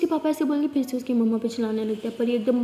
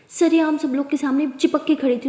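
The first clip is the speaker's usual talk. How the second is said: usual speaking voice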